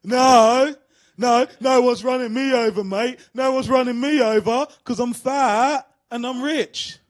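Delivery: put-on loud, slurred, defiant drunk; drunk man's reply. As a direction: boom it out, smug and drunk, slowing at the end. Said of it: "To which he responded".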